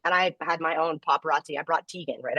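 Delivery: usual speaking voice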